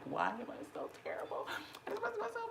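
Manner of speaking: very high, fast, wailing cartoon despair; hammed-up wail. Mocking her own despair to viewers, very high hammed-up wail. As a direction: wail it out in overblown self-pity. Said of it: "that's how I felt".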